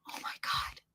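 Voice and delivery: breathless whispered gasp of words; shocked whisper. Retold or imitated. Owner watching her dog die, whispered shock.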